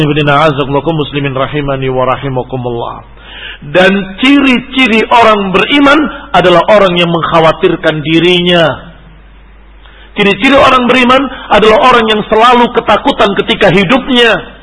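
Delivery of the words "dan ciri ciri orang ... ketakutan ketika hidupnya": raised, ringing sermon voice; insistent preacher, pitched up high; emphatic preaching. Acted. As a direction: drive it home to the congregation. Preaches to congregation.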